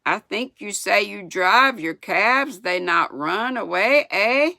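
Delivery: sputtering indignation. A narrator's reading. French boy chiding the driver after the spill, indignant. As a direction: taunt with mild indignation, ending high on the tag. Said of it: "Pierre sputtered and wiped the snow".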